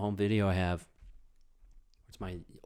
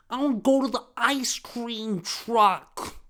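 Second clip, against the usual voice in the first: every word stretched, high and loud; over-enunciate like a demanding small child; slow, hammed-up kiddie shout; intensely enunciating kid; 14 semitones higher, 8 dB louder, slower than usual. Shown as a demonstration. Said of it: "enunciated words really intensely when I was a little kid".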